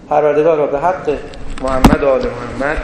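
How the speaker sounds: low, hushed supplication; closing prayer. Acted. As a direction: pray earnestly to close the sermon.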